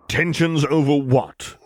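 slow menacing growl. Acted. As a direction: growl the question slowly. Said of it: "he growled".

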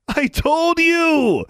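put-on high, slow snickering boy's gloat; snickering taunt. A narrator's reading. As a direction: drag out the gloat, high and mocking. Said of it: "Larry snickered to his friends".

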